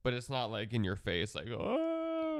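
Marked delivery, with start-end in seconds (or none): 1.5-2.4 over-the-top gasp. Pretend. Showing an in-your-face boy band vibe.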